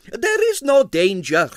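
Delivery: high, slow coaxing Russian voice. A narrator's reading. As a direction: coax him gently in a high foreign voice. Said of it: "he said coaxingly".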